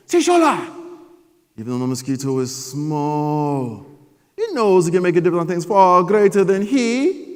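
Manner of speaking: loud, slow, solemn father voice; wise dad. Retold or imitated. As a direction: hand down wisdom like an old sage. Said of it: "like, I got his voice down".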